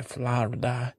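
"Florida" said the local way, on its own; "Florida" in a put-on local pronunciation. Shown as a demonstration. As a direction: imitate how locals pronounce "Florida". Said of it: "that's how they say it here".

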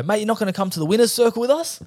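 high-pitched, surprised question; incredulous invitation. Retold or imitated. Retold owners inviting him along.

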